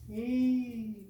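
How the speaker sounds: "L" as a long held "lll"; letter sound. Shown as a demonstration. Teacher sounds out L after F, held. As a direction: hold the consonant sound of "L". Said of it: "Tenemos entonces F".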